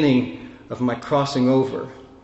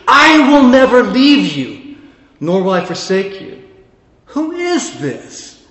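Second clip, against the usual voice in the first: higher and louder, slow on hine, set back; full-force preaching; 9 semitones higher, 7 dB louder than usual. Acted. Climax of the sermon.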